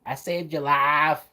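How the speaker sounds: slow Mississippi accent, lightly imitated; a light Mississippi accent impression. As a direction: copy Rogue's Mississippi accent again. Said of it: "they did try to have her with an accent".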